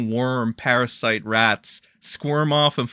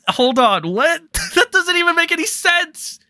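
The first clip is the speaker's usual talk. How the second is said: high, stunned, laughing disbelief; can't believe what you read; incredulous; 4 dB louder than usual. Real, not acted. Streamer reacting to a ridiculous take, incredulous.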